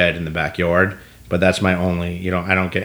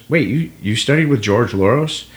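mock surprised question; 4 semitones higher, 3 dB louder than usual. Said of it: "it was a bait question".

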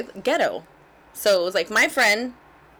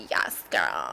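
much lower, put-on girly affectation; Becky voice; 4 dB quieter than usual. Daughter going back and forth with mother, Becky voice. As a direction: play a mock Becky. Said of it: "we call it the Becky voice".

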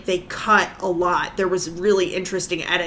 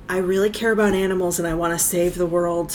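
quick, soft earnest voice; lightly mock the sincere nice guy; earnest do-gooder; 3 semitones lower, 4 dB quieter, faster than usual. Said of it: "comes off more like".

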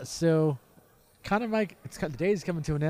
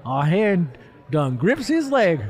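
slow hammed-up Southern drawl, higher; Southern accent; 8 semitones higher, 7 dB louder, slower than usual. Finishing the blurb, slow hammed-up Southern accent. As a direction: stretch it out like a country storyteller. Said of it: "special accent".

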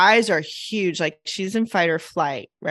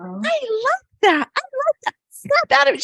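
put-on very high child's voice, tickled; mimicked child's delighted laughing squeal; imitated cracking up. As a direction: squeal it like a little girl in hysterics. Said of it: "she just starts cracking up".

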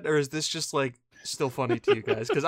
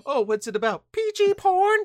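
put-on kid and mom voices; 3 dB louder than usual. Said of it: "and be like".

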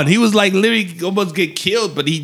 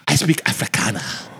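lower, softer imitated South African accent; 5 semitones lower, 5 dB quieter than usual. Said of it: "very distinct accent".

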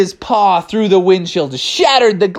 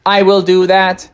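robotic monotone; Techno Bear; 2 semitones higher, 3 dB louder than usual. Acted. Robot bear accepts Bubby's order, monotone robot voice. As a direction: answer like an obedient robot. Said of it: "And Techno said".

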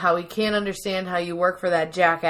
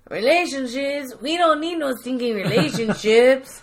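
hammed-up Mexican accent, pitched up; 4 semitones higher than usual. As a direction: parody a movie bandit's Mexican accent. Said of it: "in a Mexican accent".

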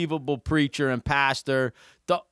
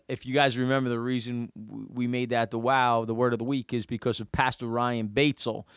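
ordinary talk, nothing marked

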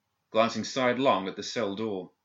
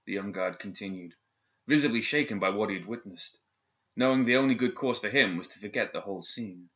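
plain reading voice

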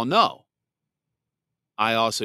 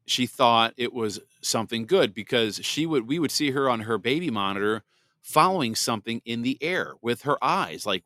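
usual speaking voice

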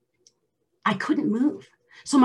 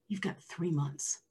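much softer and slow; grim quiet verdict; 4 semitones lower, 12 dB quieter, slower than usual. Retold or imitated. Doctor delivering a death sentence, soft and slow. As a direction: let the news fall quietly.

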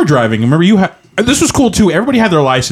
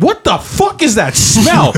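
high, slow disgusted outburst; disgusted surprise; 9 semitones higher, slower than usual. Retold or imitated. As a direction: recoil at the stench. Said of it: "I'm like".

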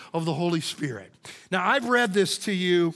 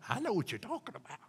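knowing congregant; 9 semitones higher, 8 dB quieter than usual. Retold or imitated. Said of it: "and you're going".

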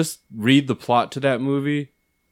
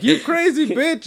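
mock yell; 3 dB louder, slower than usual. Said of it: "he's just like".

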